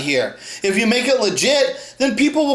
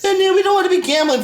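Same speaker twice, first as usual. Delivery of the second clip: high, fast, mocking whiny voice; mocking whine; 9 semitones higher, faster than usual. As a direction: parody critics whining about gambling. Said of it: "come out here and cry about gambling".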